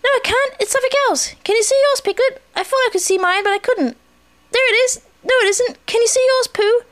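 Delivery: very high, eager little voice; excitable young Roo. A narrator's reading. Roo checks on friends' sticks, high and eager.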